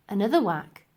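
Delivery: plain reading voice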